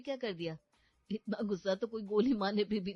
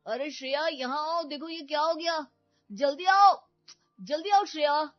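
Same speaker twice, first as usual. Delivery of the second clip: higher, much louder urgent call; shouting for wife; 8 semitones higher, 12 dB louder than usual. Acted. Husband luring his wife out of the room, loud fake-urgent shouting. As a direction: yell for her to come quickly, faking alarm. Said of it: "ऐसे क्यों चिल्ला रहे हैं".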